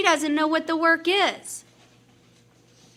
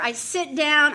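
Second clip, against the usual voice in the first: ordinary talk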